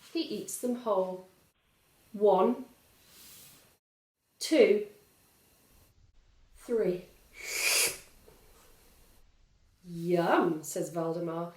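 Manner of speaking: put-on lively read-aloud for small children; storybook narration. A narrator's reading. Reading a picture book aloud to young children, lively. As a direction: tell it brightly to little listeners.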